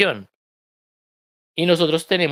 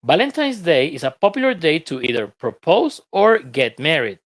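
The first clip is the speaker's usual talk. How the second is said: reading the text aloud; 3 semitones higher than usual. Acted. Teacher reads the lesson passage to students, lively. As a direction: read the passage to the class with energy.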